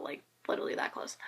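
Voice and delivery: excited hushed whisper; whispered thrill. Real, not acted. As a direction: breathe it out like a secret. Thrilled secret about being close.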